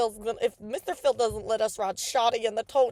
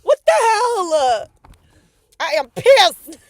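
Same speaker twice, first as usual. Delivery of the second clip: furious yelling; angry shout; 5 semitones higher, 11 dB louder, slower than usual. Real, not acted. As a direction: shout it out in anger. Car won't start, yelling.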